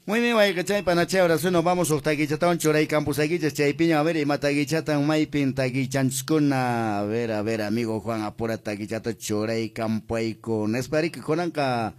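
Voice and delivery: loud, high, fast hype read; booming station sign-off; radio announcer. Acted. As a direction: rattle off the shout-outs like a DJ. Host's loud show sign-off.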